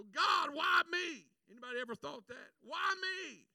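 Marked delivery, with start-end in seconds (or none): 0.0-1.3 loud, slow, desperate plea upward; a preacher's wail of why me; anguished cry to God. Retold or imitated. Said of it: "you go". 2.6-3.5 higher, pleading gripe to God; lightly acted self-pity; whining complaint.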